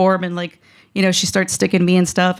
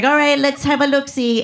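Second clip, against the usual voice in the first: put-on higher, cute robotic ESL accent; foreign doctor's accent; 5 semitones higher than usual. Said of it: "I'm not trying to make fun of her accent".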